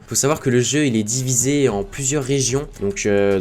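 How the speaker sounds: ordinary talk, nothing marked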